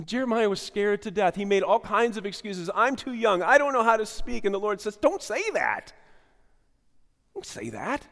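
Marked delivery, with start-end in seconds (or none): 2.7-4.5 higher, louder whiny excuses; Jeremiah pleading. Acted. 5.0-5.9 high, loud firm rebuke; the Lord rebuking. Acted. The Lord answering Jeremiah. 7.3-8.1 lower, soft gentle repeat. Acted. Repeat, softer.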